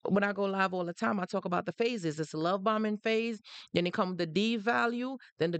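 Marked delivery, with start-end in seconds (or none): none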